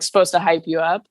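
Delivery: ordinary talk, nothing marked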